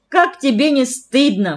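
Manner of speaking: high, indignant scolding; flaring up. A narrator's reading. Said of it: "вспылила сестра". (возмущённо, вспылив)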